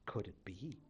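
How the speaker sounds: low, soft disbelieving murmur; hushed wonder. Acted. Chief recognizing his son, soft awed murmur. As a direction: breathe it to himself in awe. Chief realizes boy's identity.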